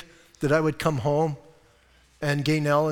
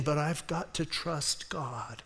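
quiet inner resolve; 4 semitones lower, 6 dB quieter than usual. Retold or imitated. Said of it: "she's thinking".

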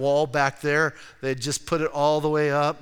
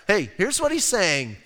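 ordinary talk

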